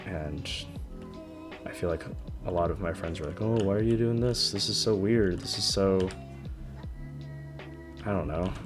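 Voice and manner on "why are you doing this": ordinary talk, nothing marked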